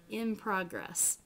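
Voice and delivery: In progress with an M sound before the p. Shown as a demonstration. Teacher showing assimilation to students, slowed down. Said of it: "You say an M more than an N".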